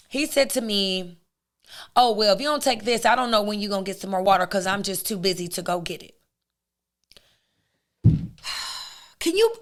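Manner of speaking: normal talking voice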